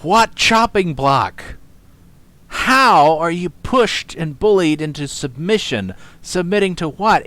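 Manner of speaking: loud, high and slow disbelief; stunned loud questioning; incredulous. Real, not acted. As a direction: demand answers in disbelief. Incredulous rebuttal to clip.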